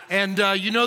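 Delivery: ordinary talk, nothing marked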